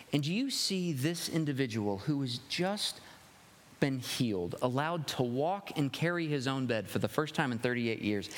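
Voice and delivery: normal talking voice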